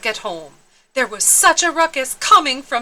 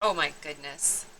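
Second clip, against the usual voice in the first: quiet; 7 semitones lower, 11 dB quieter than usual. Real, not acted. Narrator aside, hushed.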